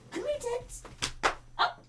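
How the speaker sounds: very high, soft little voice; coaxing pet talk. Real, not acted. Owner coaxes Teddy up, tiny high pet voice. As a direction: coo very high and softly to the dog.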